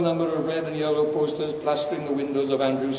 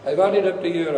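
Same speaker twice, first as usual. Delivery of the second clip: ordinary delivery, nothing marked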